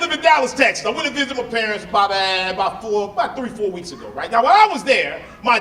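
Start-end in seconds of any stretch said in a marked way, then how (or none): none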